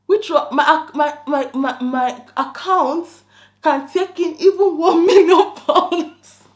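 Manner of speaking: incredulous. Real, not acted. Mocking the scammer's upgrade claim.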